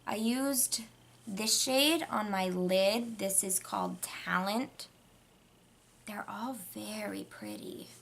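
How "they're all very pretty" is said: whispering. Real, not acted.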